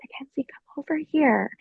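hushed, voiceless beckoning whisper; imitating a secretive summons; retold whisper. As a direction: whisper it softly, as if calling someone over discreetly. Star beckons her over, retold.

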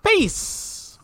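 very high, drawn-out farewell; signature sign-off. Real, not acted. Host signs off until next week, squeaky high signature farewell. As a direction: pop way up in pitch on a parting word. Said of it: "Do that every time".

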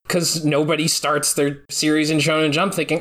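ordinary talk

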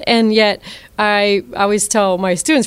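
ordinary talk, nothing marked

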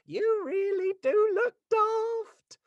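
high, loud, very slow sneer; mean hyena voice. Acted. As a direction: drag out the insult like a gloating hyena. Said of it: "That was my hyena for you".

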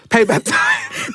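slow, drawn-out payback time; relished slogan. Retold or imitated. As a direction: stretch it out like a threat in fun.

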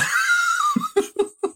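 doctor's laugh. Retold or imitated. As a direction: laugh out in disbelief. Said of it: "he looks at me and goes".

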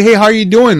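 bright, high, loud hello; excited greeting. Retold or imitated. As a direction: greet them like you're thrilled to see them. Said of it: "excited, man".

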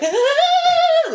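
short high mock scream; retold little scream. Dog owner reliving walk home, high mock scream. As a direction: squeal it like you touched dog mess. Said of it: "I scream literally the whole way".

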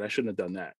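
low, soft note to self; quiet self-reproach. Retold or imitated. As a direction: drop the voice, as if thinking it privately. Retold self-reproach, said then repeated emphatically.

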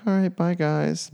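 slow, high sing-out goodbye; casual goodbye. Retold or imitated. Cousin leaving the basketball game, drawn-out casual goodbye. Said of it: "She's like".

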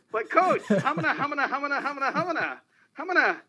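high, laughing hamana stammer, stuck for an answer; mock Ralph Kramden stammer. Reporter mimicking himself stammering at the coach, laughing. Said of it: "that was [name] going".

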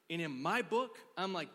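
ordinary talk

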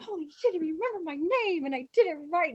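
high, excited exclamation; thrilled disbelief. Retold or imitated. Extra amazed the director knew her name, thrilled. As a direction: gush at being recognised.

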